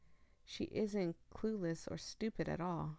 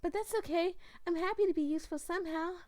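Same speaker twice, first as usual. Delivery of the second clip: high, quick, forced cheerful Lala; 11 semitones higher, 4 dB louder, faster than usual. Acted. Lala hiding her pain from the player, forced cheer. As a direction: chirp it brightly to hide the hurt. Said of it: "She's smiling".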